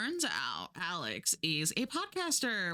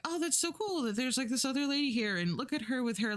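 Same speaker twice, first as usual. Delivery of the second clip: high, fast, starry-eyed gush; gushing admirer; 6 semitones higher, faster than usual. Retold or imitated. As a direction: rush it out, dazzled by the other woman. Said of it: "she's like".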